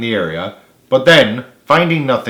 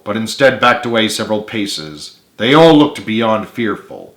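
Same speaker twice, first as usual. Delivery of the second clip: plain reading voice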